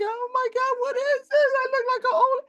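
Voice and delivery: high, tearful mock wail; retold crying. Telling friends about her pruned hands, a high retold wail. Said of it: "and I started crying".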